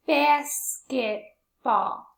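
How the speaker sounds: BASKETBALL slowly as "bas... ket... ball"; syllable by syllable. Shown as a demonstration. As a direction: sound out BASKETBALL in three separate beats. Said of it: "How many syllables is that?".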